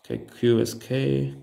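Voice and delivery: ordinary talk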